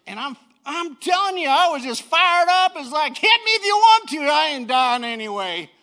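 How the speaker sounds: high, loud preacher's yell; exultant shouting, stretching the key words; fired-up preaching. Real, not acted. Preaches story at full force.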